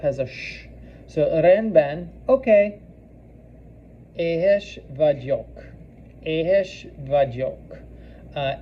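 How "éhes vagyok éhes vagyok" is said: Éhes vagyok said very slowly, long é then short e; careful slow teaching repetition; slow drill. Shown as a demonstration. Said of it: "elongated E again with the short E".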